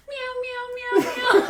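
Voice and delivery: high, cat-like meowing. Shown as a demonstration. Comic shows her on-stage catchphrase, high cat meows. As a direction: meow like a cat. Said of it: "catchphrase I actually did have".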